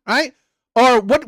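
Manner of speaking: ordinary talk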